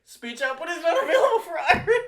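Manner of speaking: very high, loud, through laughter; cracking up in exasperation; laughing exasperation. Real, not acted. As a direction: read the error in a squeal of laughing disbelief. Second failure, reading error while laughing.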